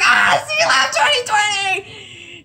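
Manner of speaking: high, drawn-out excited scream; slow, stretched shriek of delight; re-enacted fan squeal. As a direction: squeal the title like an overjoyed fan. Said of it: "I was like".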